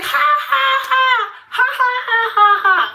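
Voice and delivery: very high, drawn-out mock cackle; imitated laughter. Mom reacting to pregnancy news, mimicked high laughter. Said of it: "Started laughing".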